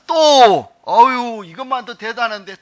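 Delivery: loud, high burst of amazement; excited exclamation. Real, not acted. Pastor marvels at the verse before the congregation, loud and high.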